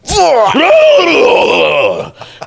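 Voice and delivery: high, loud laughing scream at the TV; retold scream. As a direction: let out a wild yelling laugh. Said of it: "I'm screaming at the T V".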